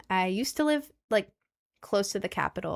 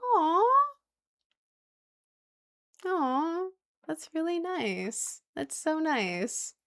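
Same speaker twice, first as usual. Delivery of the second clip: high, softened, slow coo; tender gushing; 8 semitones higher, much slower than usual. Real, not acted. Streamer thanking a couple for their message, tender gushing. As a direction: coo with real affection, stretching the words. Moved by a viewer's kind message.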